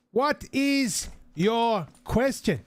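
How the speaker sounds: high, slow barman talking for a tourist; slow tourist English. Retold or imitated. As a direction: stretch it out for the foreigner. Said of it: "slowing down their English tune".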